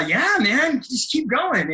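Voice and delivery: mock pep-talk encouragement; encouraging cheer. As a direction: cheer them on in a lifted, swooping voice. Imitates mentor cheering them on.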